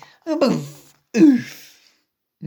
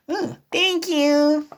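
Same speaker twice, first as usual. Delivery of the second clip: plain reading voice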